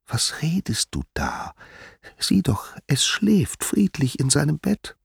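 put-on breathy whisper defending the baby; hushed, incredulous mother; whispered protest. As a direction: whisper so the child sleeps on. Mother protests beside sleeping baby.

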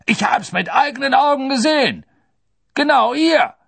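high, loud furious shout; enraged executioner. A narrator's reading. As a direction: shout your protest in a rage. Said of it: "sagte der Henker wutentbrannt".

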